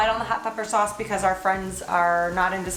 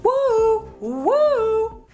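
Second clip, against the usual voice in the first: excited woo; 11 semitones higher, 4 dB louder than usual. Real, not acted. Excited cheering after zooming out.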